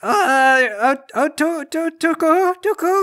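high stammering alien squeaks, climbing; flustered alien woman. Acted. Alien woman grabbed around the waist, high stammering. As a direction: stammer upward as a tiny startled alien.

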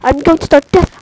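normal talking voice

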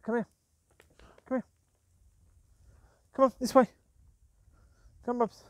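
loud high call. Real, not acted. Owner steering dogs along, loud high call. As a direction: summon the dogs this way.

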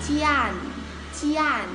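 "Jian" said short, high and strong; demonstrated syllable. Shown as a demonstration. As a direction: punch out each "Jian" as one syllable. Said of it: "Tương tự từ Ian mình đọc là". (đọc "Jian" dứt khoát, giọng cao)

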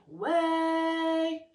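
"Way" held high on an open EH; loud sustained "Way" with space on the A, barely any ee; open A vowel. Shown as a demonstration. Teacher showing the richer vowel, "Way" sung on open A. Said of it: "instead of the A".

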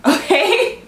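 okay said through a high laugh; giggly okay. Real, not acted. Amused aside.

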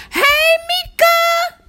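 very high, stretched-out squeal of hello; excited high-pitched greeting. Real, not acted. Streamer greeting a viewer, excited high-pitched squeal. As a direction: squeal the name slowly, way too excited. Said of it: "my voice get high-pitched".

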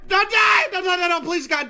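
high, loud cry of alarm; real gamer panic; panicked pleading. Real, not acted. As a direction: shout it as a desperate plea. Begging the creature not to die.

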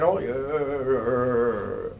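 slow, exaggerated vocal creak; mock croaky voice. Shown as a demonstration. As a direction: drag the words into a long croak. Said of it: "my voice gets croaky".

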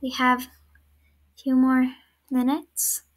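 usual speaking voice

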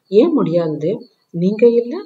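emphatic. A narrator's reading. Woman challenging Dev's doubt, emphatic. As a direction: press the question hard. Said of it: "அழுத்தமாக கேட்டவள்".

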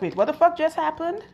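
high, stunned disbelief; shocked outrage. Retold or imitated. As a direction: react in shock. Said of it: "my energy is like".

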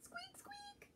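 very high, tiny squeaks; high little mouse squeak; squeaky mouse. Acted. Mouse voice in picture book.

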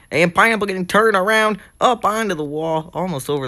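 excited crash call. Real, not acted. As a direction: call the sudden spin as it happens. Live call of a sudden crash.

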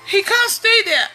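usual speaking voice

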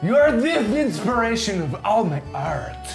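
hammed-up French art host accent; fake French accent. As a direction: play a pompous French artist, thick mock accent. Hosts in a put-on French accent.

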